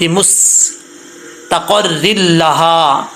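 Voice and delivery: لمستقر لہا drawn out very slowly; slow recitation. Shown as a demonstration. Teacher recites slowly for pupils to repeat. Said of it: "آواز جم جائے گی".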